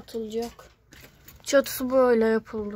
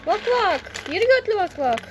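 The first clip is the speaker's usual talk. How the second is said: chicken call; 6 semitones higher than usual. Real, not acted. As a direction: sing out to lure the chickens over. Calling the chickens to eat.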